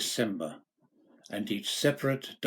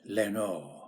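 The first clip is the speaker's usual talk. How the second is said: soft, distant echo of the name; murmured echo; 4 semitones lower, 4 dB quieter than usual. A narrator's reading. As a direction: let it come back faint, like an echo. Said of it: "an echo murmured back".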